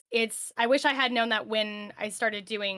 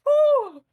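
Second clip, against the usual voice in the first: high, loud whistling whew; exasperated whew; 9 dB louder than usual. Retold or imitated. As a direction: blow out a big relieved whew. Recalling a cringey first stream.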